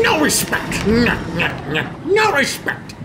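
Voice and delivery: high indignant whine; mock outrage. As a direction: whine about no respect.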